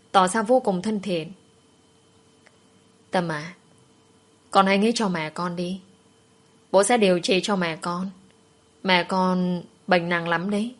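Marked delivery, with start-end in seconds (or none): none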